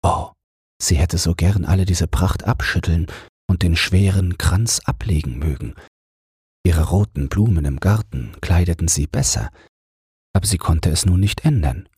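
ordinary delivery, nothing marked

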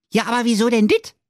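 high, quick piglet question; astonished. A narrator's reading. Marzipeter questions the huntsman, astonished. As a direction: blurt it out high and puzzled. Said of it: "fragte der Marzipeter erstaunt".

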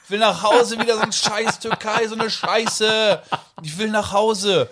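put-on high, drawn-out drunken whining. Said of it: "voll am Rumjammern und Rummeckern".

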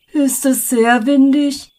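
a slow, high voice rising from underground; muffled from deep below. A narrator's reading. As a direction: call up muffled from the grave. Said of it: "Stimme erneut gedämpft aus der Tiefe".

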